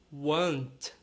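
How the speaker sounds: "Want" with a W like U and a light T; soft final T. Shown as a demonstration. Teacher modelling "Want" for her students. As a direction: keep the T of "Want" gentle. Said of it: "o T também é bem tranquilo".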